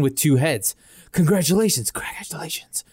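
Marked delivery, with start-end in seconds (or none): 1.1-1.9 two-headed man voice. Acted. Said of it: "Two voices behind you echo". 1.9-2.8 whispered, like a faint echo. Acted. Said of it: "Two voices behind you echo".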